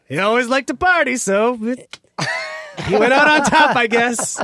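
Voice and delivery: mock offhand joking. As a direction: crack a callous joke, stretching the punchline. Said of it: "making jokes about [name]".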